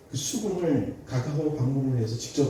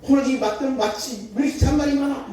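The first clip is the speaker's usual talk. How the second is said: furious yelling mother; 10 semitones higher, 4 dB louder than usual. Retold or imitated. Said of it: "소리를 지르는 거예요".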